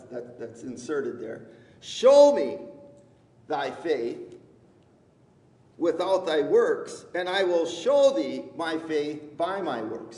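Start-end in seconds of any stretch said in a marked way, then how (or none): 1.8-10.2 scripture reading. Acted. Preacher reading the challenge of faith and works, declaimed. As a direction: proclaim it firmly, pausing between phrases. Reads scripture aloud to congregation.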